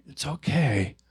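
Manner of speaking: soft reassuring words to yourself; tender. Shown as a demonstration. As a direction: soothe it like a crying baby. Soothing the hurting parts.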